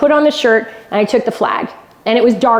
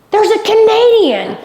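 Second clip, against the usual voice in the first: high, slow, drawn-out call of surprise; excited crowd cry; 11 semitones higher, slower than usual. Retold or imitated. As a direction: call it out like spotting someone in a crowd. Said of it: "somebody says".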